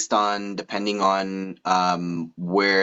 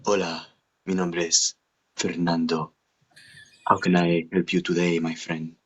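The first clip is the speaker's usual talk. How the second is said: low, soft Latin lover voice; smooth Latin lover, unhurried pauses between phrases; Fernando; 4 semitones lower, 4 dB quieter than usual. Shown as a demonstration. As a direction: seduce in a low Spanish accent. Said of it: "like, a Latin lover kinda guy".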